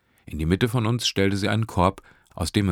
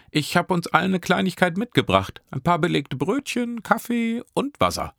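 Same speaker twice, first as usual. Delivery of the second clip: put-on much higher, cheerful young woman; sweet Nesrin; 13 semitones higher, 3 dB louder than usual. A narrator's reading. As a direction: offer the treats warmly and brightly. Nesrin offers food. (Nesrin bietet Brötchen an, viel höher)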